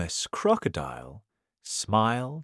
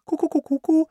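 high, hooting cuckoo calls; piping storybook bird noise; 5 dB louder, much faster than usual. Acted. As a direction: imitate a bird with quick high coos. Bird call after introduction.

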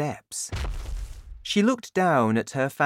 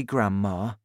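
ordinary delivery, nothing marked

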